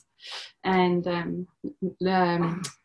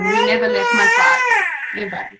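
high, loud, voice breaking into sobs and laughs; crying; 12 semitones higher, 6 dB louder than usual. Real, not acted. Mother crying and laughing about her supportive partner. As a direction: cry and laugh through it.

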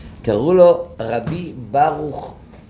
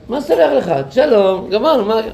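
much higher, brushing off the apology; 7 semitones higher than usual. Retold or imitated. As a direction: wave it away with a high, eager "what is there to forgive". Plumber waving off apology.

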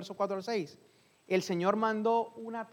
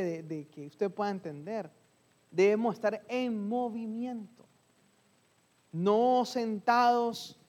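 ordinary delivery

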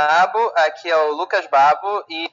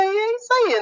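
squeaky; faster than usual. Pretend. Echoes Florencio's squeaky ending.

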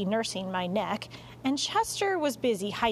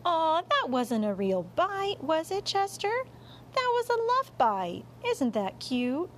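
cooing mother; 8 semitones higher than usual. Acted. Mom excusing the cat's bite, cooing in a high voice. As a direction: gush over a naughty darling pet.

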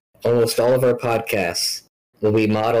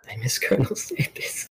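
whispered, with a laugh breaking in; mock sad whisper; 2 semitones higher, 5 dB quieter than usual. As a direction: mourn the mascot in a joking whisper. Mock mourning Colonel Sanders.

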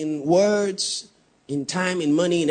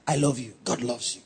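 hushed; 4 semitones lower, 6 dB quieter than usual. Acted. Drops to soft repetition.

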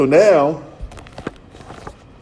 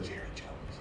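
whispered; 24 dB quieter than usual. Retold or imitated. Marla's answer retold.

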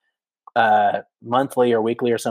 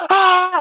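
sudden high-pitched scream of terror; imitating a friend's panicked yell; retold scream; 5 dB louder than usual. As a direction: shriek like a passenger about to crash. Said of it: "just screaming his head off".

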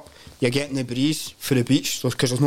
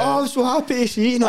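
high beaming voice; cheery greeting; 12 semitones higher, 3 dB louder than usual. Retold or imitated. To the housewoman, high eager greeting. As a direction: greet her brightly, far too keen. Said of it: "And I'm like".

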